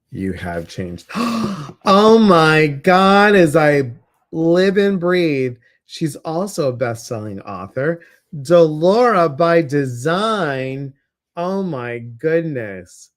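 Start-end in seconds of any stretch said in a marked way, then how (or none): 1.1-1.8 sharp high gasp of delight; surprised gasp. Real, not acted. Surprised by a guest joining. 1.9-13.1 loud, slow, high delighted greeting; excited. Real, not acted. Thrilled to see guest.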